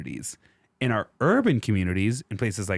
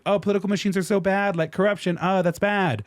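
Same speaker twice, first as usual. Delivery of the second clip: high, fast, sing-song mockery of the naysayers; a mock-prissy complaint, high and quick; mocking whiny quote; 8 semitones higher than usual. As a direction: parody people clutching their pearls, pitched up. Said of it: "everyone today is like".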